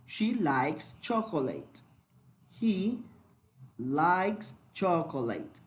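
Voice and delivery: likes said slowly with a clear final S; stressing the S. Shown as a demonstration. Teacher showing the added S for the class, slow and clear. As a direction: lean on the S at the end of likes. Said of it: "debemos agregarle una S".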